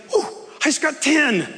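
a little pleased brag about ten points; pleased scorer. Pretend. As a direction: gloat over the points. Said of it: "When it's like".

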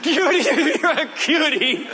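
put-on high little-boy voice with laughter; imitated six-year-old greeting, breaking into laughs; Hardy. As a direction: gleefully coo it like a small child. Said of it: "met with the same greeting".

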